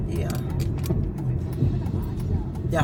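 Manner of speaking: usual speaking voice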